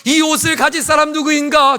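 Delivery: high, loud jeering question; preacher voicing a jeering crowd; mocking crowd. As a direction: call out the taunt from the pulpit. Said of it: "조롱할 것입니다".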